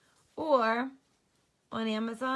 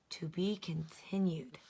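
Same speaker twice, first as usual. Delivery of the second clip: whisper; 4 semitones lower, 5 dB quieter than usual. Acted. Suspense ending read.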